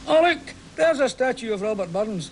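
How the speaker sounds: hammed-up surprise at a statue; mock surprise. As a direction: act like you've only just spotted it. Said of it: "as if you've just seen it for the first time".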